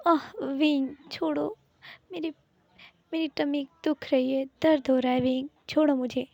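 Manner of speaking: wife pleading with husband to release her sore tummy, pained and high. Acted. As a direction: gasp and beg him to let go of your stomach. Husband gripping her aching stomach.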